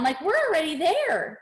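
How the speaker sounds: high, giddy quoted voice; excited make-believe. Acted. Speaker voicing a body that believes it's already there, excited. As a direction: voice a thrilled holiday daydream.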